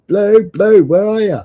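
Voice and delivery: high calling out for the auntie; calling out a name. Retold or imitated. Nephew calling his auntie in the wrong house, high and loud. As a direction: call through the house for her. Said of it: "I'm shouting her name".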